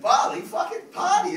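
loud, high whoop of a party-goer; mock party cheer. Friends mocking Bali as a party trip, loud whoop. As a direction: shout it like a tourist off to party.

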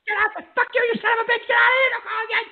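high, worked-up dismissal; angry outburst. Real, not acted. Host kicks an abusive caller off the show, angry. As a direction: curse the caller off the line.

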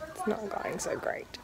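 unvoiced wry aside; whisper. Real, not acted. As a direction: whisper it as a private joke. Aside about pizza order.

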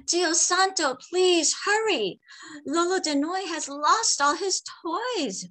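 put-on high, urgent boy's plea; desperate plea. A narrator's reading. Frightened boy begging the medicine man at midnight. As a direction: beg him to hurry, close to panic. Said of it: "Benjo cried".

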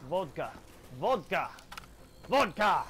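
increasingly forceful mock-German "vodka"; German-accent warm-up. Shown as a demonstration. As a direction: say "vodka" as VOHD-ka, punching it. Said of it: "I have to say the word vodka first".

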